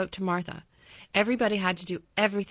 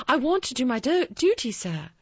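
high, louder, stumbling deference; eager servant; 5 semitones higher, 4 dB louder than usual. A narrator's reading. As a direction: hurry to assure the master, pitched up. Anxious servant promises obedience.

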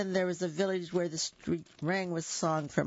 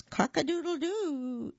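high crowing cock-a-doodle-doo; higher crowing call; rooster crow; 12 semitones higher than usual. Shown as a demonstration. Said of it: "is what we expect".

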